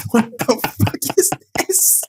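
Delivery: slow, high squeak, almost voiceless from laughing; incredulous wheeze. Retold or imitated. Friend cracking up over the giant octopus, incredulous wheeze. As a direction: wheeze out comic disbelief.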